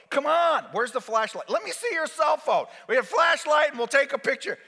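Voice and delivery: high, loud, urgent orders; acted frantic nurse; pushy insistence. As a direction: badger the patient excitedly.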